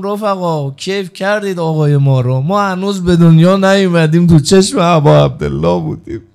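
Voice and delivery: loud, ringing sermon cry; impassioned rowzeh proclamation; full-force preaching. Acted. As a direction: call it out to the whole hall like a preacher. Preacher voicing Husayn to companions.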